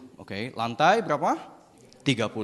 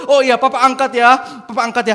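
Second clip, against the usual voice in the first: loud, raised-pitch promise; urgent father; 7 semitones higher, 10 dB louder than usual. Acted. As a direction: call it to your dangling son.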